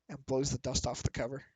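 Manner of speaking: usual speaking voice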